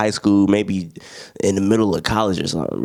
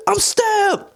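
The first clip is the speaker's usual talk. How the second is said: much higher, stunned cry of pain; shocked and hurt. Retold or imitated. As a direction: say it in disbelief at the wound. Blake just stabbed.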